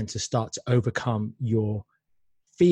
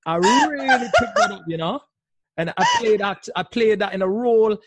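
playful put-on Rasta accent, pitched higher; higher, louder Rasta accent with a laugh in it; 10 semitones higher, 4 dB louder than usual. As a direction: show off a Rastafarian accent from an old movie. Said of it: "picked up a kind of Rastafarian accent".

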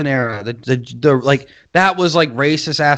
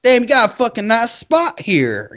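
mock Southern girl; 8 semitones higher than usual.

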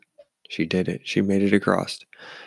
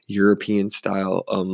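ordinary delivery

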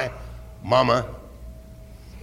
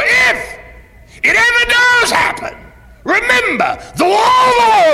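rising from firm to full-throated high shout; preaching shout; 5 dB louder than usual. Real, not acted. Preacher to congregation, building to a high shout. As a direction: build it into a ringing cry to the back row.

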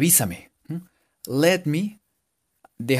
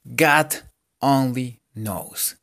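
slow model phrase; much slower than usual. Shown as a demonstration. Teacher to students modeling the phrase slowly. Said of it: "Podemos aprender esta".

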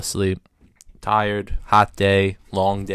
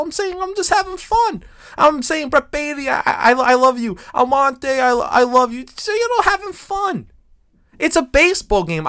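high indignant pleading; 14 semitones higher, 4 dB louder than usual. Retold or imitated. Defending himself, retold, pitched up.

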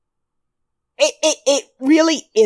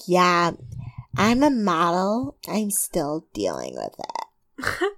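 slow, lower, airy mock diva; mock model; 4 semitones lower, 4 dB quieter, slower than usual. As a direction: drawl it like a self-pitying model. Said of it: "And Christina's like".

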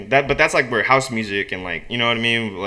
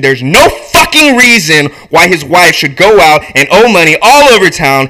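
indignant tirade climbing to a shout; acted righteous outrage; building rant; 5 semitones higher, 5 dB louder than usual. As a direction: work yourself up louder and higher. Walter's building rant in script.

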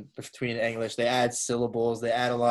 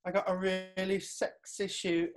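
higher, softer breezy influencer boast; 6 semitones higher, 5 dB quieter than usual. Retold or imitated. Host mocking influencers, higher and breezy. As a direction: boast about a shoot. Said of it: "internet accent".

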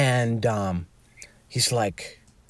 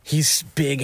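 ordinary delivery

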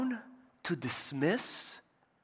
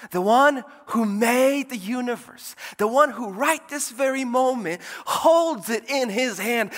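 high, loud, driving proclamation; full-force preaching; 10 semitones higher, 8 dB louder than usual. Real, not acted. Preacher telling church who says do not be anxious, fervent proclamation. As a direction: build the titles of Jesus into a ringing climax.

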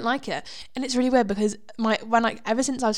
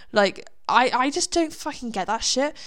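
ordinary talk

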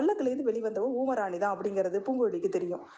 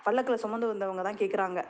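ordinary delivery, nothing marked

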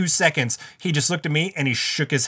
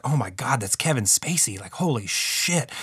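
hushed; 3 semitones lower, 6 dB quieter than usual. Retold or imitated. Starstruck whisper to self.

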